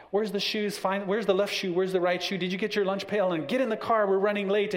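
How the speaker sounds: hurried rush. Retold or imitated. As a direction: fire off the questions, running late.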